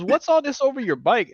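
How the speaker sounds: lightly voiced high incredulous question; incredulous buddy. Buddy spots the bike, mildly incredulous.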